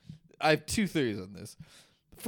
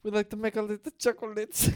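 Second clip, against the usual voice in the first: mock German accent; 7 semitones higher, faster than usual. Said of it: "Not a good German accent".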